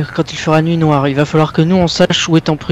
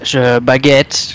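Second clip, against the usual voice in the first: mock French accent; much slower than usual. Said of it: "avec cet accent français".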